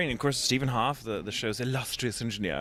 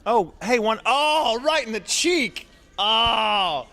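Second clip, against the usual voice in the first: high, louder startled cries, slowing on "right in the cheek"; mock yelp; 12 semitones higher, 5 dB louder, much slower than usual. Actor in booth yelping as M&M hits his cheek. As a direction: yelp as candy hits your face. Hit by thrown M&Ms.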